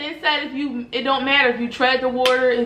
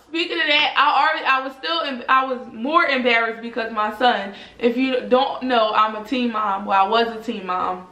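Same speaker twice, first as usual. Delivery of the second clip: ordinary talk, nothing marked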